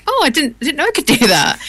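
high-pitched, delighted discovery; retold surprise. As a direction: act out amazement at your own voice. Retells surprise while playing with kids.

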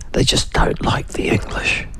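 hushed, whispered aside; confiding whisper. Real, not acted. Host confiding his verdict on the French, in a whisper.

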